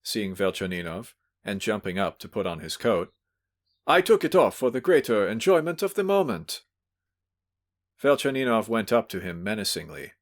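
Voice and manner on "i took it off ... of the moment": effusive. Acted. Drunk host explains himself.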